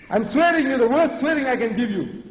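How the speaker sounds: high, insistent oratory; emphatic declaration. Real, not acted. Repeats the line with emphasis.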